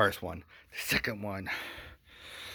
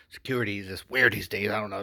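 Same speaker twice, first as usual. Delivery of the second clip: ordinary talk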